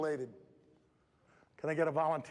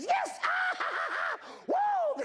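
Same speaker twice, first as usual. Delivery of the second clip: excited cheer; slower than usual. Retold or imitated. Imitating the girl cheering mid-race, high and gleeful. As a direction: squeal with joy like a kid sprinting. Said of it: "She goes".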